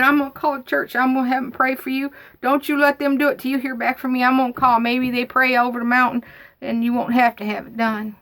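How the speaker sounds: high, insistent imitation of a worried aunt; fretting on and on. Aunt promising to get the church praying, insistent worried carrying-on. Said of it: "She just kept going on and on and on".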